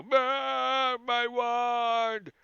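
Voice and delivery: bleating sheep. Acted. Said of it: "you hear the sheep go".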